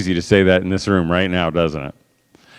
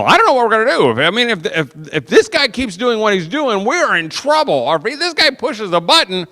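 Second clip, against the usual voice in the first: much higher, worried mock freakout; mock panic; 10 semitones higher than usual. Parent frets in front of the kids, mock panic. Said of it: "if you're freaking out".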